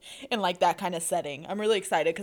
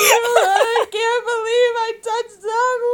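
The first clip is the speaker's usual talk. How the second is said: loud, high, drawn-out hammed-up wail; mock sobbing; 14 semitones higher, 9 dB louder, slower than usual. Imitating a sobbing fan's snap to friends, mock sobbing. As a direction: imitate a friend blubbering in disbelief. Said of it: "all it is is her sobbing".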